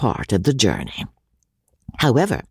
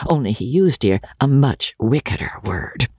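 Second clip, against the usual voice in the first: plain reading voice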